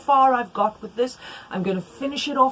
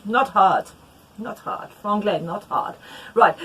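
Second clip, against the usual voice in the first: ordinary talk, nothing marked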